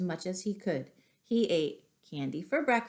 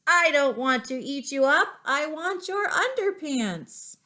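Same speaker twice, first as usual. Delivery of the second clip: loud, higher, stretched dinosaur roar; T-Rex roar; 7 semitones higher, 6 dB louder than usual. A narrator's reading. T-Rex demanding a man's underpants, loud roaring voice. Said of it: "he roared with deafening rants".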